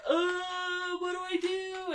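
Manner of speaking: high, hammed-up flustered cry; panicky Slughorn. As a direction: play a helpless old man in a panic. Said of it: "just like".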